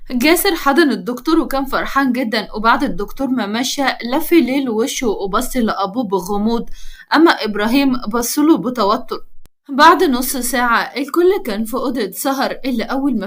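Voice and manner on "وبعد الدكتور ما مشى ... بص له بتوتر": usual speaking voice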